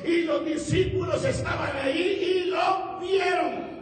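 high, slowing, exultant sermon cry; preaching. Acted. Preacher giving glory for the freed man, exultant preaching. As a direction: proclaim it with rising force.

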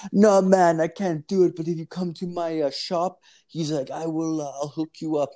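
a light Jamaican lilt, pitched well up; Jamaican accent. Retold or imitated. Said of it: "It's, like, a Jamaican dude".

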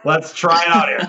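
loud, bold overconfident announcing; fake confidence. About to botch a band test, declaring it boldly. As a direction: psych yourself up before you flop. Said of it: "I would own it".

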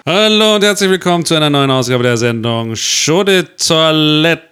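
loud, bright show opener; game show host. Pretend. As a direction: welcome listeners like a TV host.

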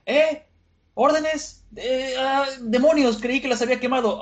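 high, flustered stammer into a curse; high-pitched acted fluster; caught off guard. Caught-out villain reacting to trick.

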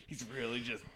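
normal talking voice

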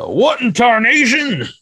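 put-on high, slow old-timer yelp; squeaky, slowed prospector imitation. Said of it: "give me the, you know, being a big fan".

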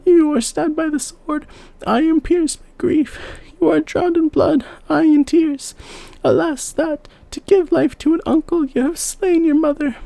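very high, wailing grief; a mother mad with grief over her dead children; high keening mother's lament; frantic lament. A narrator's reading. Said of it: "she cried aloud like one mad".

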